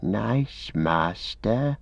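very slow, soft creature wheedle; soft, fawning creature voice; soft Gollum. A narrator's reading. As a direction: stretch each word out softly, fawning on the master. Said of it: "said Gollum softly".

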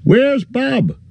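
a fussy, friendly innkeeper bending down; cheery innkeeper. A narrator's reading. Innkeeper greets the hobbit, high and bustling.

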